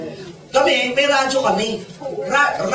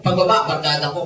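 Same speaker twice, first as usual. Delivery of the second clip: ordinary delivery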